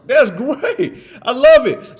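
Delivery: loud, high burst of excitement; excited. Retold or imitated. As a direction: cheer it out, thrilled. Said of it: "I was like".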